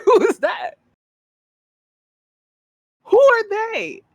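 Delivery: high-pitched exaggerated disbelief; high mock outrage. Mock confusion at names.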